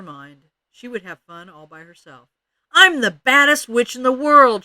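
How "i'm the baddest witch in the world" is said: loud, high chanting boast; muffled singsong witch. A narrator's reading. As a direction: crow it out loud and high like a child playing witch. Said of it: "she sang in her muffled voice".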